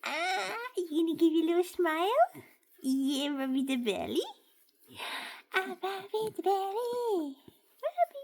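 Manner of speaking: high, loud squeaky pet voice; baby talk. Acted. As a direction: coo at the dog, begging for belly rubs.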